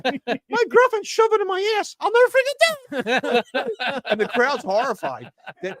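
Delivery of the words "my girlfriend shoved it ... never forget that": much higher cute dummy voice; squeaky puppet voice. Acted. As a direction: chirp it like a tiny cute puppet. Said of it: "he says".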